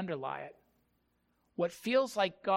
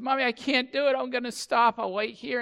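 put-on higher, louder childish refusal; mock kid's stubborn whine, pitched up; whining little kid; 7 semitones higher, 7 dB louder than usual. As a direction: imitate a child giving up and protesting. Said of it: "the younger of the two kids say".

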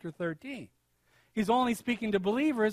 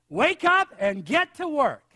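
slow, higher, louder rallying order; quoted loud command; 5 semitones higher, 6 dB louder, slower than usual. Retold or imitated. Preacher quoting an article's order, loudly imitated. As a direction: proclaim it like a blunt wake-up call.

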